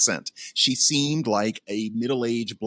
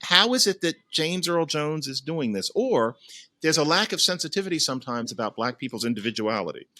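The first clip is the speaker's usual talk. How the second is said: ordinary talk, nothing marked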